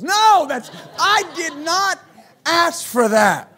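high, loud, slow indignant yell; exasperated shout. Retold or imitated. Pastor yelling at Alexa for playing the wrong song. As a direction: bark it at the machine.

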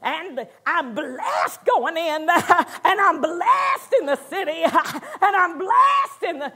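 slow, loud, laughing mock proclamation; mock showy declaring. Preacher mocking loud faith-by-volume to the church, laughing parody shout. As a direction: ham up the blessing confession with volume. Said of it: "I wasn't saying it like".

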